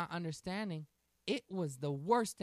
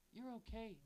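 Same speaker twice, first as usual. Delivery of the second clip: softer, hushed daddy comfort; 5 semitones higher, 8 dB quieter than usual. Retold or imitated. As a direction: murmur it to calm the child. Father calms daughter.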